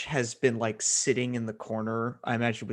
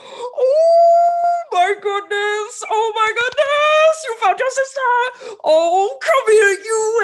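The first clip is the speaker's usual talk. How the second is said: very high, loud drawn-out overjoyed wail; bursting with joy; 10 dB louder, slower than usual. Acted. Haj greets two reunited sisters, squealing with overwhelmed joy. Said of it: "tries to hold back it so hard, but eventually she just goes".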